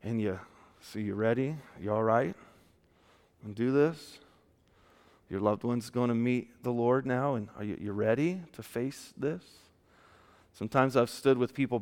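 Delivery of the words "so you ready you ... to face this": soft, low, halting questions; gentle compassion. Retold or imitated. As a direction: ask tenderly, as to someone losing a loved one. Gently asking the grieving if ready.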